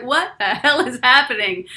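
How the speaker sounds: retold disbelief, punched out loud; astonished. Stunned listener reacting to a powerful woman preacher, astonished.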